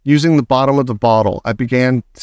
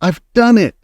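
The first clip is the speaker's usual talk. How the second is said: high, loud burst of triumph; boy bursting in with his prize; excited cry; 8 semitones higher, 3 dB louder than usual. A narrator's reading. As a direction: crow it out with delight. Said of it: "I cried".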